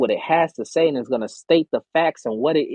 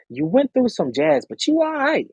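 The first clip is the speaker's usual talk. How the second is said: high reassurance; 11 semitones higher than usual. Real, not acted. High comforting.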